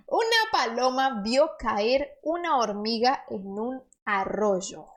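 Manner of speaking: careful Spanish reading; Spanish model reading; reading aloud in Spanish. Acted. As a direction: read the sentence to the learners. Reads Spanish sentence.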